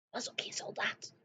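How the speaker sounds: breathless voiceless whisper; barely a breath, afraid to be heard. Acted. Sophie correcting the giant in a bare whisper. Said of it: "fluisterde Sophie".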